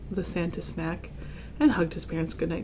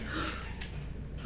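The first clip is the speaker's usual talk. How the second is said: gasp; 14 dB quieter than usual. Acted. Gasp as Santa wakes.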